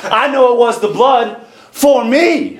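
slow, high line landing hard on "for me"; stress on FOR ME. Shown as a demonstration. Preacher quoting the song's last part, stress on "for me". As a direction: draw it out, then punch "for me". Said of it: "until you read the last part".

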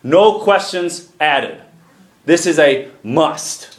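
slow, deliberate build to a stressed "must"; emphasis on must. Real, not acted. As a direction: spell it out slowly, landing on "must". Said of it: "I want to emphasize that word".

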